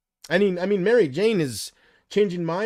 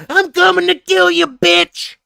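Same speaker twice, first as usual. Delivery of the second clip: higher, louder shouted threat in a put-on voice; Nick Welsh threat; 12 dB louder, slower than usual. Imitating Nick Welsh threatening someone, shouted. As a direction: yell it like a crazed man threatening. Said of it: "Nick Welsh style".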